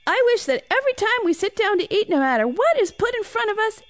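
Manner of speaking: put-on high-pitched, rushing kid voice; blurted out. A narrator's reading. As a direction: blurt the wish eagerly before anyone can cut in. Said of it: "Noah blurted out this wish".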